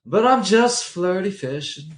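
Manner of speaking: high, slow playful cult voice; mock innocent. Cohost answers the villain bit, playfully coy.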